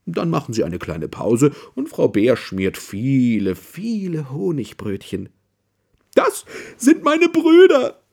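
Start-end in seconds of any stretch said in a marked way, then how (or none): 6.1-8.0 Mrs Bear's voice. Acted. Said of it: "sagt sie zu Herrn Hase".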